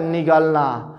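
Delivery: ordinary talk, nothing marked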